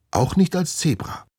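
put-on high girl's voice. Voices Nina in story.

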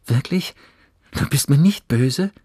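put-on high, loud, delighted boy's question; light up with relieved surprise; beaming relief. A narrator's reading. Johannes asks whether his friend isn't angry, beaming. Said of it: "Johannes strahlte".

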